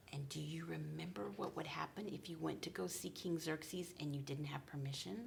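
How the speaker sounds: hushed whisper to build suspense; storyteller whisper to the kids. Acted. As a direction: whisper it like a scary secret.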